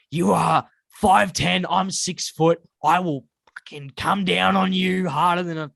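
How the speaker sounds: slow, emphatic tough-guy threat; mock threatening. Telling how he threatened to bash his mate, mock menacing. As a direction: puff up and loom over him, word by word. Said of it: "threatening to bash him".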